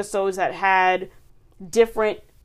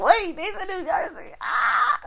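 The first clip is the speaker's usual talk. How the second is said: high-pitched excited squeal, acted out; mock excitement; 10 semitones higher, slower than usual. New Jersey local reacting to the episode, high and excited. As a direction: play the thrilled hometown fan. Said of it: "I was so excited for that one".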